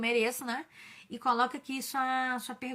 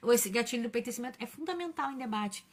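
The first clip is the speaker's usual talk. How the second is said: ordinary talk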